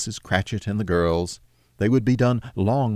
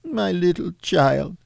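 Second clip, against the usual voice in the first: breaking down; 10 semitones higher than usual. Acted. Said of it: "He broke down all at once".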